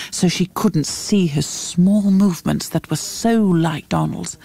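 plain reading voice

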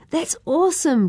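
high, thrilled exclamation; overjoyed for his brother; excited cry. A narrator's reading. Yosefa cheers his brother's news, high and thrilled. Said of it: "cried Yosefa".

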